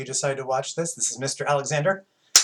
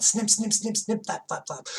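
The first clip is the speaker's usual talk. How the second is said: high snipping; 9 semitones higher, 4 dB quieter than usual. A narrator's reading. Bat noises in story.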